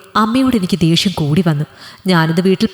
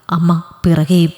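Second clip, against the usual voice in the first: plain reading voice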